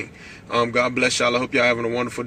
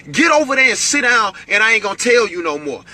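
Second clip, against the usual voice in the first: higher, louder stern warning; escalated parent voice; 8 semitones higher, 4 dB louder than usual. Shown as a demonstration. Parent escalating at a child who won't listen, stern raised voice. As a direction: raise your voice like a fed-up parent. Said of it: "your voice has to get escalated".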